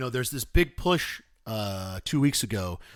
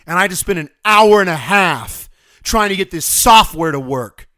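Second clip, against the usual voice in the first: loud, raised frustrated rant; exasperated shout; 4 semitones higher, 10 dB louder than usual. Real, not acted. Parent kept from the park by software, exasperated shout. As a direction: hammer out the grievance loudly. Returns to the lost time complaint.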